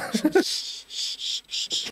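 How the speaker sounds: breathy high giggle; high whispered giggle. Real, not acted. Laugh after quote.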